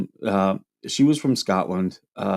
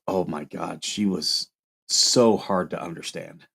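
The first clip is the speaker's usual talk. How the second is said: usual speaking voice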